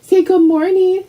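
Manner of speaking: high, coaxing pet voice. Real, not acted. Urging her dog to say hi, high baby talk. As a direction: coax the dog to greet. Prompting dog to greet viewers.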